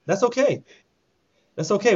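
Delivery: okay sung up high; lilting high repeat; high sing-song. Pretend. Repeating it, going with the flow.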